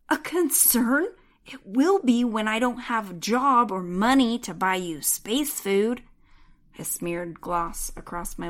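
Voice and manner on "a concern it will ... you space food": loud, incredulous exasperation; exasperated. A narrator's reading. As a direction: push back at the cat, louder. Heroine exasperated at cat.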